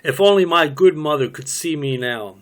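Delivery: ordinary talk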